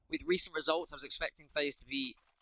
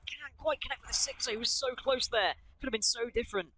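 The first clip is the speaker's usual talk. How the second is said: excited; 8 semitones higher than usual. Real, not acted. Near miss on a frag.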